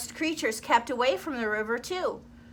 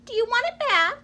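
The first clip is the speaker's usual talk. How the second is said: much higher little mouse; 12 semitones higher, 4 dB louder than usual. Acted. Mouse offering the crocodile his tooth, high voice. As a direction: offer it back playfully. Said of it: "the mouse offers the tooth".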